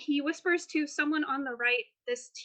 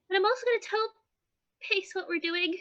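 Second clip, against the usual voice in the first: lightly voiced, higher-pitched helper; earnest game character; 6 semitones higher than usual. Depp telling the party his plan, earnest and higher. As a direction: lift the voice as a small, earnest character.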